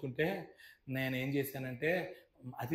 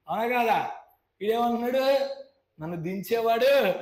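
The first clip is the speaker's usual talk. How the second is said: high, loud questioning sermon; fiery preaching; 5 semitones higher, 5 dB louder than usual. Real, not acted. Preacher quizzing his congregation about the healing story, fiery and loud. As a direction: drive the questions at the crowd.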